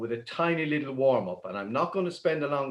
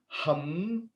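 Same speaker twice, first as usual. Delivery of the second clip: humming; 2 semitones higher, 5 dB quieter than usual. Shown as a demonstration. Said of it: "start with the letter H".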